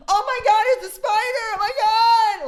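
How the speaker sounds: very high-pitched shriek; imitated panicked scream. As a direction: scream like an audience member terrified by a spider. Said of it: "she screams in the middle of my set".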